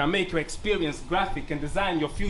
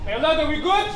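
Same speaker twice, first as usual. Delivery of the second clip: loud, high call to the crowd; 9 semitones higher, 5 dB louder than usual. Real, not acted. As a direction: shout it out to the room. Rapper calls out to crowd.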